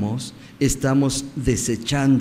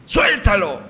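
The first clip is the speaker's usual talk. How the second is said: raised, high-pitched cry of a mob; crowd's shout; 6 semitones higher, 3 dB louder than usual. Retold or imitated. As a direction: call it out like the crowd demanding a release. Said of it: "gritaría". (grito de la multitud)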